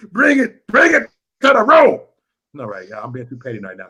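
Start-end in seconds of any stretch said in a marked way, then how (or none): 0.1-2.0 high, loud rallying holler; excited calling out. Real, not acted. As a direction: holler it to the whole room. Whooping at the chat after laughing.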